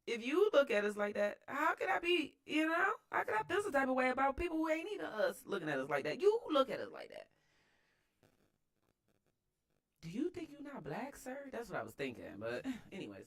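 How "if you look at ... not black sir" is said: much higher and louder, disbelieving; rising indignant outrage at the uncle; incredulous retort. Retold or imitated. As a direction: throw the question back at him in disbelief. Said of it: "looked at this man like".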